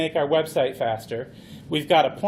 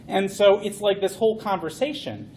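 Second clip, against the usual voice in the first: ordinary talk, nothing marked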